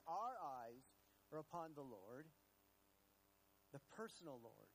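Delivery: usual speaking voice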